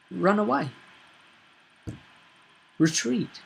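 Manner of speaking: usual speaking voice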